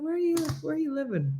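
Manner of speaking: high, quick, hushed disbelief; incredulous. Retold or imitated. Teller questions friend who never heard of paella, incredulous. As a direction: rattle it off in high-pitched disbelief.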